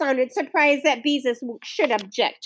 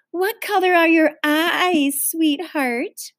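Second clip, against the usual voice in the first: higher, slow sugary question; cooing to a child; 6 semitones higher, slower than usual. A narrator's reading. Neighbour leans over to Ramona, cooing. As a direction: coo it slowly, pitched up for a little one.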